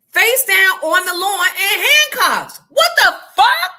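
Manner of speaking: high, loud, slow disbelief at the sight; stunned yell at seeing the neighbor cuffed; shocked outcry. Retold or imitated. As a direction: cry out in shocked outrage. Shocked at arrest scene.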